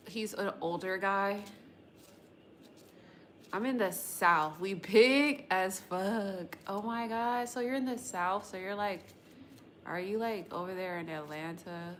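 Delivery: normal talking voice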